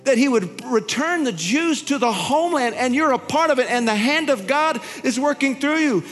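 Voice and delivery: high-pitched, fervent sermon voice, slowing on the big lines; impassioned preaching. Retold or imitated. Preacher retelling his prophetic words to Nella, in fervent high preaching. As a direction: preach it at full force, voice lifted high.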